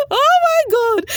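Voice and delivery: high, loud helpless laugh. Real, not acted. Vlogger cracking up at the mom's words, high laugh. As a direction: burst out laughing. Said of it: "I was laughing and crying".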